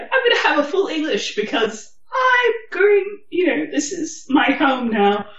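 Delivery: high, bright mock-excited voice; naive excited self. Mocking her younger self to the listener, high and gushing. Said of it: "And so I was like".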